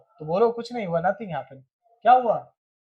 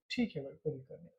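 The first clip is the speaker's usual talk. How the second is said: soft reassurance; 3 semitones lower, 14 dB quieter, faster than usual. Acted. Reassuring someone who apologised.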